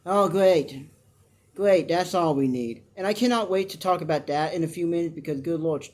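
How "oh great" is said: normal talking voice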